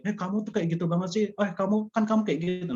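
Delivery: high, fast, scolding accusation; harsh accusing tone. Shown as a demonstration. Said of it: "itu kan terlalu, eh, intonasi atau nadanya".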